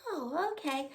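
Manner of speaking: soft, small acted answer; toddler's meek reply. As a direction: imitate a pacified little child, very softly. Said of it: "She's like".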